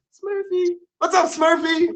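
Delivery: high, bright hype welcome for the guest; excited greeting. Real, not acted. Host welcoming guest Smurfy with a high excited greeting. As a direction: call her name up high like an announcer.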